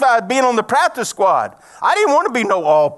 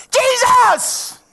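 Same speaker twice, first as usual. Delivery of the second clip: very high, loud call; crying out; 7 dB louder, much slower than usual. Retold or imitated. Blind man calling to Jesus passing by, loud cry. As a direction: shout for him across the crowd. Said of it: "he kept crying out".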